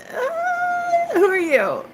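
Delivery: very high, drawn-out "uh", then sliding down; put-on high voice. Acting out meeting room five, high put-on squeak. Acts out meeting the new man.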